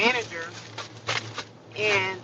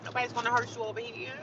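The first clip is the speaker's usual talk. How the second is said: usual speaking voice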